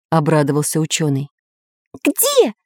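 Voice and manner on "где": high startled where; surprised. A narrator's reading. Pencil surprised at the scientist's words, high surprised. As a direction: squeak it out in surprise. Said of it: "удивился Карандаш".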